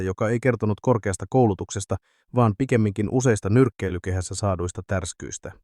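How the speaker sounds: ordinary delivery, nothing marked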